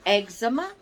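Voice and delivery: "eczema" as EG-zuh-muh, front-stressed; modern pronunciation. Shown as a demonstration. Vlogger puzzled by the changed name, modern EG-zuh-muh for "eczema". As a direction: hit the first syllable of "eczema", EG-zuh-muh. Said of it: "changed it and started calling it".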